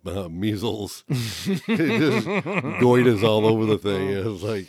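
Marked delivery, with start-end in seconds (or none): 1.1-4.1 laughing through the words. Real, not acted. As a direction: laugh while describing it. Laughing at lumpy repair jobs.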